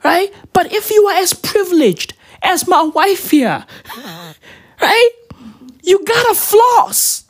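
high, laughing mock scolding; mock lecture. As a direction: scold them in a high comic voice. Comic rant.

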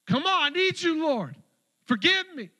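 loud, high cry to God; desperate plea. Acted. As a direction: plead for mercy at the top of the voice. Acted desperate prayer.

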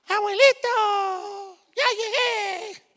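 put-on very high, slow, sing-song child; squeaky granddaughter greeting; little girl voice. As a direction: call grandpa like a tiny girl.

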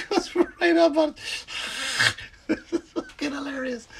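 high-pitched laughter through words; breaking up with laughter mid-sentence; laughing. Real, not acted. Cracking up over the cat.

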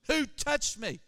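urgent call. Acted. Jesus calls out to the crowd, loud and urgent. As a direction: raise the voice, demanding who. Jesus repeats, louder and higher.

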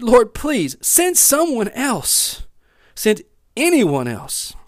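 high, drawn-out plea; pleading. A narrator's reading. Moses begs God to send another, pleading. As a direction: beg slowly, stretching the words. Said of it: "Moses again pleaded".

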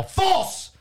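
sudden high yell of false. Retold or imitated. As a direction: shout it at the whole room. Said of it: "I just yelled them all".

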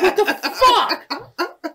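high, loud, drawn-out disbelief; baffled outburst. Retold or imitated. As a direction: stretch it out in comic exasperation. Retold outburst at puzzling toy directions.

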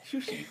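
"Sushi" said very high, as "shushi"; playful, squeaky "Sushi" with a doubled sh; tongue-tied sushi. Shown as a demonstration. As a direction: mangle "Sushi" with sh in place of the s. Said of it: "I have a hard time saying".

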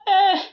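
loud, high mock cry; imitated wail. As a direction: burst out bawling like a child. Imitated outburst of a crying child.

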